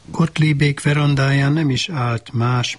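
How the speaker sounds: usual speaking voice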